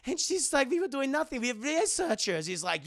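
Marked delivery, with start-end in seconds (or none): 0.7-2.5 put-on higher-pitched defensive German accent. Elsa defending herself to Indy, German accent. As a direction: deny it in a mock German accent. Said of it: "You have a very German accent".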